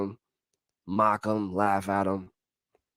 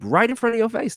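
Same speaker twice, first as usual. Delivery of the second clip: pitched way up on front; excited high point; high emphatic; 12 semitones higher than usual. Real, not acted. Emphatic repeat.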